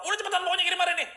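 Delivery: bentakan cepat, nada jauh lebih tinggi dan lebih keras; marah-marah. Retold or imitated. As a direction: desak dengan tak sabar dan cepat. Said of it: "pokoknya dia marah-marah". (pelanggan marah menyuruh segera kirim, cepat dan keras)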